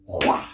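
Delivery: loud, sudden mock slap noise; imitated slap sound. Showing how he slapped her, loud imitated whack. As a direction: burst out an explosive smack sound. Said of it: "Like, he just went".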